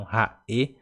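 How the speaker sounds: "raê" cut into ra, ê; separated syllables. Shown as a demonstration. Teacher explains the apostrophe to learners, "raê" with split syllables. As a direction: break "raê" between the two vowels. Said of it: "ele separa, como se fosse separar a sílaba".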